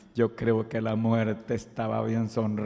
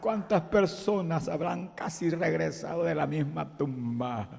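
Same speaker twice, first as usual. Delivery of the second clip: exhortación fuerte y aguda que va bajando; predicación ferviente; 9 semitones higher, 17 dB louder than usual. Acted. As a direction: animar a la congregación a adorar. Exhorta a adorar. (predicación ferviente)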